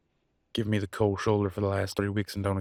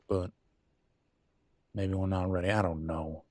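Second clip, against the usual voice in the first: ordinary talk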